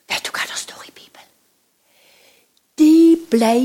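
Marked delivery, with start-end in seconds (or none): 0.1-1.3 whisper. Acted. Secretive storytelling.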